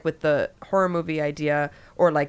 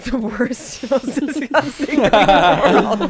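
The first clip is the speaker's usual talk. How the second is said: laughing, louder; 5 semitones higher, 7 dB louder, slower than usual. Real, not acted. Laughing emphasis.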